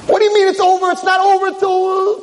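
high, loud disbelief; outraged objection. Acted. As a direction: argue back frantically at the nurse. Patient objects to death pronouncement.

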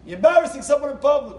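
sudden high, loud scolding; loud, emphatic reproach; sharp rebuke. Retold or imitated. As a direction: raise your voice to make him see it. Rebbe rebukes the screaming husband.

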